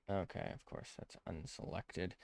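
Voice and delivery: whispered, exasperated aside. Real, not acted. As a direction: mutter it under your breath. Annoyed aside under breath.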